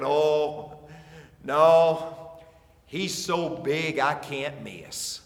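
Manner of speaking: usual speaking voice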